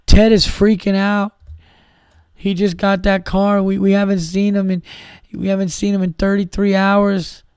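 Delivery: hammed-up, high-pitched frantic phone call; mock crying aunt. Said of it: "calls you up crying".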